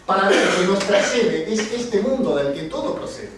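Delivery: emphatic spoken-word poetry with swinging pitch; poem recitation. Acted. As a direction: declaim the rhymes, landing on each wordplay. Recites own wordplay poem.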